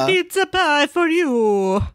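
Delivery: cheery Italian chef voice; jolly Italian pizza chef. Acted. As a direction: offer the pie like a beaming cook. Voicing the pizza chef Antonio.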